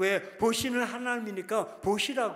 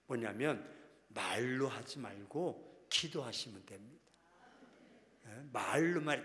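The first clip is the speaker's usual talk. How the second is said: usual speaking voice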